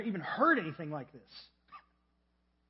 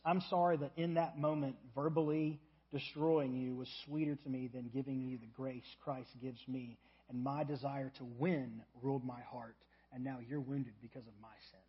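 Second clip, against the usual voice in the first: sincere repentance; 6 semitones lower, 6 dB quieter than usual. Acted. Spouse quietly confessing to a wounded partner, sincere and soft.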